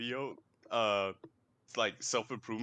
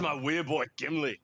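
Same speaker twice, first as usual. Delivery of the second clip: Scottish dwarf; 3 semitones higher, 5 dB louder than usual. Acted. Echoing the Scottish dwarf quote.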